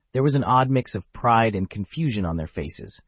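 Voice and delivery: ordinary delivery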